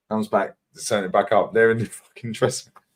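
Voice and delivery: ordinary talk, nothing marked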